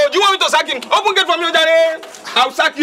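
angry threat. Acted. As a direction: order the gateman with a threat. Boss threatens to sack gateman.

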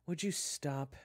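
high, loud exasperated plea; exasperated groan. Acted. June tells Kyler to stop his threats, exasperated. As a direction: groan it out, fed up. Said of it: "June groaned".

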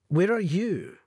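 seductive call with a slight tremble; sultry but nervous; sexy voice. A narrator's reading. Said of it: "she said in a sexy voice, but with a slight tremble".

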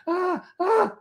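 very high frantic yelps; hammed-up frozen panic; mock panic scream. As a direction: shriek in helpless panic.

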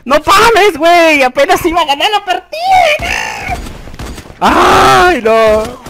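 high, loud, drawn-out lament; crying out high in agony of defeat; despairing wail. Real, not acted. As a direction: wail as victory slips away. Loses match he nearly won.

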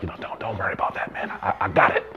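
fast, hushed whisper; a quick discreet whisper to the friend at the table; whisper aside. Retold or imitated. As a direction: whisper it quickly so the friend won't worry about the bill. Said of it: "I was like".

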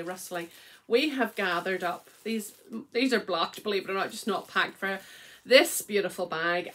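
normal talking voice

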